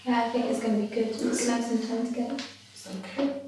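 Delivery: hushed, very soft comforting voice; gentle reassurance. Acted. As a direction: soothe Mum quietly, just for her ears. Daughter reassures her mother.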